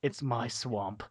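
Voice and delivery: low, slow hushed murmur; imitated whisper. Storyteller voices Shrek in the narrator's ear, mock whisper. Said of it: "he whispers in my ear".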